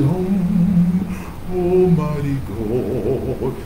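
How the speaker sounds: slow, drawn-out pious prayer voice; mock religious piety. Mocking showy churchgoers with a pious drawn-out prayer. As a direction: ham up a showy holy prayer. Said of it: "sit around and get all religious".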